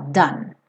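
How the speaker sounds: Done with no final e; single-syllable Done; one-syllable done. Shown as a demonstration. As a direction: say Done as dun. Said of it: "Esquece esse E final".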